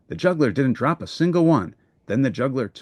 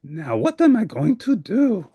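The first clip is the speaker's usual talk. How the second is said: higher, fretful voice; a helper stuck outside; worried Grover; 6 semitones higher than usual. Acted. Grover at a loss.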